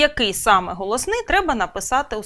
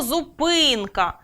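"зупинка" slowly, with a long stressed и after п; stressed clear и; much slower than usual. Shown as a demonstration. Teacher giving the check word, "зупинка" stressed slowly on и. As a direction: draw out the middle vowel of "зупинка". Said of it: "під наголосом".

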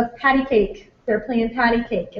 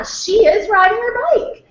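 much higher, drawn-out, agreeing statement; encouraging parent modeling grammar; 12 semitones higher, 6 dB louder than usual. Shown as a demonstration. Parent answering a child's grammar slip, agreeing and correcting. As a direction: agree and model it back, high and slow. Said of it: "the parent could say".

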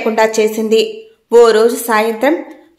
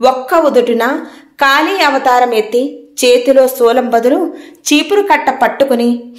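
ordinary delivery, nothing marked

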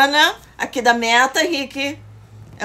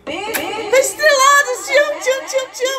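gushing high thank-you voice; excited high voice; 11 semitones higher than usual. Real, not acted. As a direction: squeal your love for the gift.

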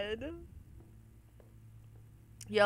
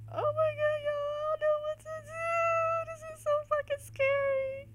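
much higher panicked squeal; terrified. Real, not acted. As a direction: squeak it out in terror. Terrified high voice.

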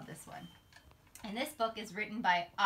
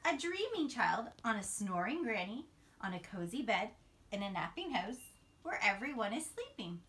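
put-on sing-song storybook read-aloud for little kids; reading to children; 2 semitones higher than usual. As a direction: read it like a bedtime picture book, rising on each new animal. Reads picture book to children.

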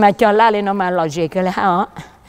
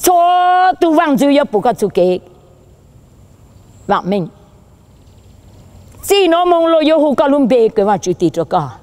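proclaiming preacher; 8 semitones higher, 3 dB louder than usual. Real, not acted. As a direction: open high on a held note, then let it fall.

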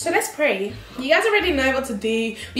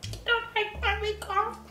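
soft, slightly raised pouting question; mock hurt; 6 semitones higher, 8 dB quieter than usual. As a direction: sulk quietly, pretending to be wounded. Daughter acts hurt by dad's criticism.

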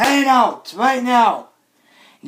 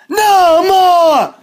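high, loud, hammed-up wail; anguished yell; 7 semitones higher, 6 dB louder than usual. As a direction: wail it out in protest. Same demand, wailed then subdued.

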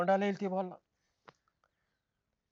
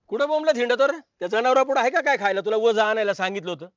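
angry shout; 3 semitones higher, 7 dB louder than usual. Acted. Father shouting for his missing son, angry and loud. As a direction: yell for the lazy son.

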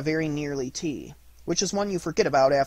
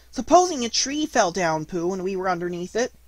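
higher, worried small-animal voice; anxious Piglet; 4 semitones higher, 4 dB louder than usual. A narrator's reading. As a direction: ask nervously as a little piglet.

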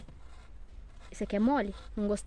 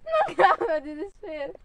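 tearful, despairing plea; crying; 8 dB quieter than usual. Real, not acted. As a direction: sob it out, desperate. Crying while stuck in swamp.

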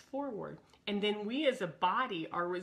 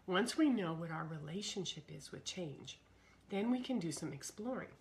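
ordinary talk

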